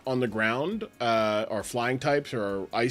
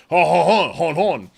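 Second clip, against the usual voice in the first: loud hammy French hon hon; cartoon French chuckle; mock French laugh; 4 semitones higher, 7 dB louder than usual. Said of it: "good French accent".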